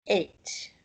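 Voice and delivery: eight started with a glottal catch; hard throat stop before eight; glottal stop demo. Shown as a demonstration. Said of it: "invisible glottal stop right there in front of that word".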